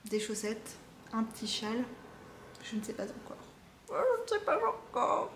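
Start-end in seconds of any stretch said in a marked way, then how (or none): 3.9-5.3 1 high silly voice. Pretend. Repeated in a high put-on voice.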